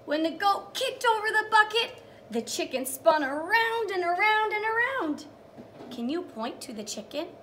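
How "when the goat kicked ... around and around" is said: higher, louder read-aloud; a teacher reading the big moment to young children; storytime reading. Acted. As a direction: sing out the page to the kids. Reads storybook aloud to kids.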